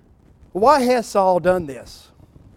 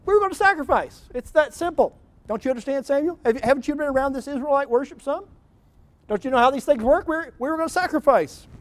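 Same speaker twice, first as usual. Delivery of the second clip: much higher pleading excuse; whiny excuse; 8 semitones higher than usual. Acted. Saul defends himself to Samuel, high whiny excuse.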